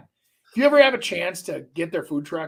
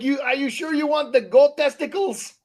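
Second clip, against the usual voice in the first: mock waiter offering; 8 semitones higher than usual. Friend retelling the Poland kebab shop, mock waiter voice. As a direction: sell it like an eager foreign waiter. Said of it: "They're like".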